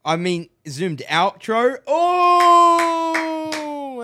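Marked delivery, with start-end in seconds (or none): 1.9-4.0 impressed oh. Real, not acted. Reacting to zoomed outro.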